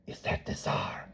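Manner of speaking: hushed whisper; awed blacksmith whispering to a Cossack; whispered question. Acted. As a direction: whisper it so the court won't hear. Blacksmith asking quietly in court.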